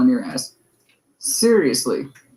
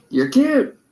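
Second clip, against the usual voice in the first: condescending mock; 9 semitones higher than usual. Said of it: "they're like".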